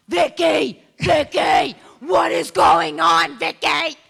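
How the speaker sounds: loud high yell across church; imitating a loud church outburst; shouting. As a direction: bellow her name, slow and loud. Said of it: "you didn't say it that quietly".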